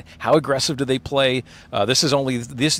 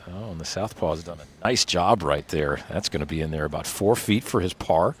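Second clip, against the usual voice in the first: golf commentator; 5 semitones lower, 6 dB quieter than usual. Acted. Live golf play-by-play.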